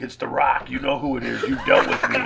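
lightly voiced tough-guy caller; a hint of The Rock, teasing; mock menacing prank call. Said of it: "doing a little rock impression".

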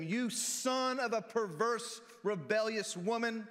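a furious king scolding his son; angry rebuke. Acted. Saul's insult read with heat.

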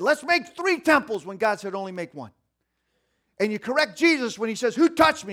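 fervent preaching. Acted. Preacher calling out Peter's mistakes to the congregation. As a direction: lift it up to the crowd.

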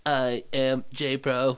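slow, put-on second voice; slow, mock-robotic alter ego; other voice. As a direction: drag it out as your alter ego. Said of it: "say it in my other voice".

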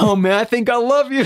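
a high, comic declaration of love; mock adoring reply. His delighted reply.

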